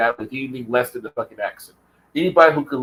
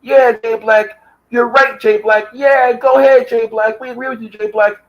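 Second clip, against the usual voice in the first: mocking fawning fans; 9 semitones higher, 4 dB louder than usual.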